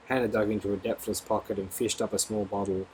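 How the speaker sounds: usual speaking voice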